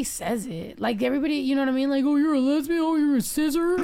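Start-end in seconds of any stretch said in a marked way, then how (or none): 2.0-3.8 lightly mocking, raised pitch quote; mimicking. Complaining to friends about what everybody says, mimicking. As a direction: parrot what people say, a bit higher.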